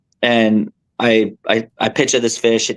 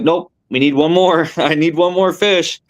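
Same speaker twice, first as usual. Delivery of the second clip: stubborn; 6 semitones higher than usual. Retold or imitated. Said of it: "I'm like".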